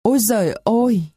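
hammed-up old woman's anguished cry; wheezing cry. A narrator's reading. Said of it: "khò khè gào lên".

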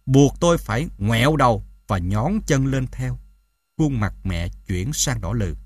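ordinary delivery

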